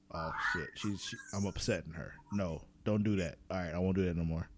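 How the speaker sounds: low, hushed self-scolding; chastened inner voice. Retold or imitated. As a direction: back down quietly, as if thinking aloud. Voices own worried thought about mom.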